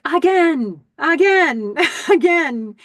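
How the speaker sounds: loud barking coach, high and hard; coach yelling again. Retold or imitated. Movie coach shouting at his team, loud drill bark. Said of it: "you're gonna hear things like".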